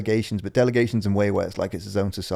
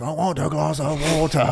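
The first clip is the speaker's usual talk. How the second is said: higher, lightly voiced confused old man; imitating old man; 7 semitones higher than usual. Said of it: "Just really loud".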